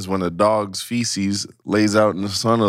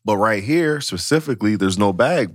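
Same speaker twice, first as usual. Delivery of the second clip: usual speaking voice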